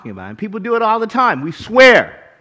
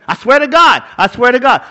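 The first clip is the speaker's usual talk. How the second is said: louder, higher repeated swearing; mock insistent oath; 4 semitones higher, 9 dB louder than usual. Preacher imitating a dishonest swearer, loud and insistent. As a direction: plead it like a liar protesting.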